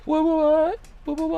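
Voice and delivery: surprised. Real, not acted. Stunned by serial number.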